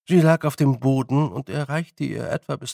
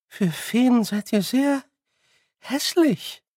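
put-on high, slow, faint old woman; frail old woman; 10 semitones higher, slower than usual. Confused old woman to the fairies, high and slow. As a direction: puzzle it out slowly like a dazed granny. Frau Grad voiced.

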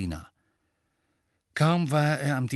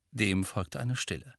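plain reading voice

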